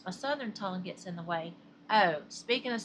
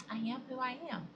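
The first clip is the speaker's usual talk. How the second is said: lightly voiced cartoon sailor, a little higher and softer; playful hint of the sailor's catchphrase voice; Popeye catchphrase; 4 semitones higher, 5 dB quieter, faster than usual. Vlogger laughing off her southern tongue, light Popeye imitation. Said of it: "as Pi Pi says".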